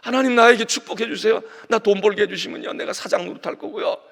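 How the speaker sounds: loud, demanding, self-serving plea; mock greedy prayer. Preacher mocking greedy believers, loud and demanding. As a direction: ham up a selfish prayer.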